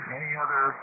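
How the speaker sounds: ordinary delivery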